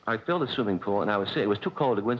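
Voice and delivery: ordinary talk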